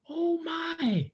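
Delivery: high breathless whispered oh my; frog gasp. A narrator's reading. Frog mother hears news, high whisper. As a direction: whisper it in wonder. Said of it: "said the frog".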